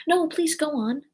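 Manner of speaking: high, pleading apology; very humble. A narrator's reading. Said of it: "Alice said very humbly".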